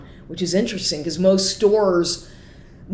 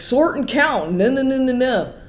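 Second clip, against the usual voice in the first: much higher mock announcer, stuttering no's; weird announcer; 7 semitones higher than usual. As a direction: play the glitchy loudspeaker voice. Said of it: "these weird kind of announcements".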